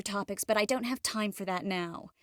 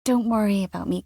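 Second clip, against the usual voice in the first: usual speaking voice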